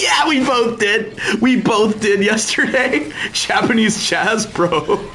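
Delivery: high, slow, laughing through the words; giggling while admitting it. Real, not acted. Streamer laughing to chat about searching Japanese jazz.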